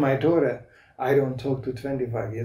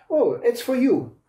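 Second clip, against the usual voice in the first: bright nurse line, higher; nurse's chirpy announcement; 9 semitones higher than usual. Retold or imitated. Nurse handing a father his baby, chirpy and high. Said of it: "a nurse with a baby and said".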